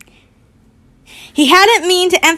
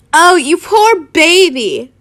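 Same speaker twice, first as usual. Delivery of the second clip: slow, raised, mocking pity; sneering; 5 semitones higher, 5 dB louder, slower than usual. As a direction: draw it out with a loud sneer. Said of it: "Harry sneered".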